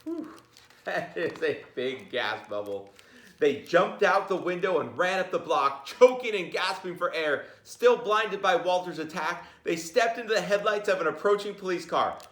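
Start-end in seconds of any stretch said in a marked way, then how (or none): 3.4-12.2 higher and louder, still racing; excited storytelling. A narrator's reading. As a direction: keep the chase going. Teacher reads picture book aloud.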